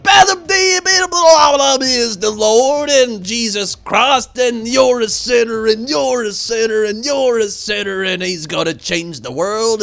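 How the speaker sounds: high, loud, slow ranting sermon; street preacher. Retold or imitated. Imitating the courthouse corner preacher, mock preaching loudly. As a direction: ham up a fire-and-brimstone preacher. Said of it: "but he said".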